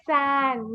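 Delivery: "San" stretched with an English cat vowel; wrong vowel. Shown as a demonstration. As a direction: draw out a short-A "San". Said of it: "No, it's not A".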